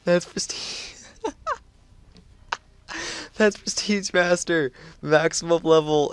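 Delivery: squeaky high laughing, words breaking apart; can barely get words out through laughter; real giggling fit. Real, not acted. Streamer sees a huge unlock and laughs uncontrollably, high squeals. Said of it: "I don't know why I can't talk".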